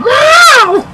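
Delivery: mock loud yell. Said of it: "but really louder".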